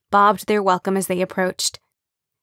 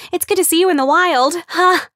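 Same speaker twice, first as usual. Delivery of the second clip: much higher, louder gush; excitable gushing; 9 semitones higher, 6 dB louder than usual. Acted. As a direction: bubble over with delight. Excitable Kim gushing at Amber.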